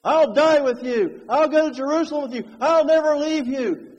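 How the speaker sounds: loud, proud mock boast; boasting Peter. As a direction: brag like a swaggering disciple. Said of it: "not talking like he talked before".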